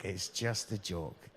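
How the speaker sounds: normal talking voice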